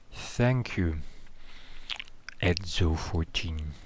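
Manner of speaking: slow, wet, methodical creature voice. Acted. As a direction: squelch the words out slowly. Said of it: "a kind of very wet voice".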